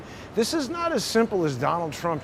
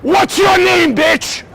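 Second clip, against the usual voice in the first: screaming much higher and louder in real rage; furious shout; 13 semitones higher, 11 dB louder than usual. Real, not acted. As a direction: yell him off the sidewalk at full force. Real street fight with cyclist.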